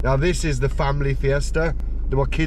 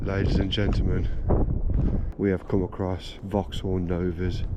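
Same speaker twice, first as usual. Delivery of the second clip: hushed; 5 semitones lower, 6 dB quieter than usual. Real, not acted. Sneaking around, afraid of being caught.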